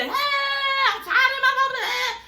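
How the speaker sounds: very high, wailing screech in imitation; mock screaming. Host mimics Portia going off before walking over, mock screaming. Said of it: "yelling and screaming and cussing".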